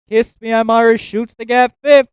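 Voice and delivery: ordinary delivery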